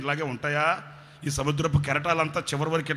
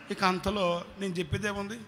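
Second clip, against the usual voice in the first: usual speaking voice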